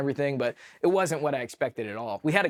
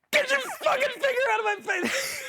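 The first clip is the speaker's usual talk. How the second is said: high, far-off mock scream of disgust; shrieking at the surgeon in disgust; outraged yell. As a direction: yell it like filth just hit your face. Shit sprayed on his face.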